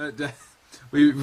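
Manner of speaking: ordinary delivery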